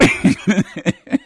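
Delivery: high burst of laughter; real laugh. Real, not acted. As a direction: laugh out loud at the story. Laughing at the story.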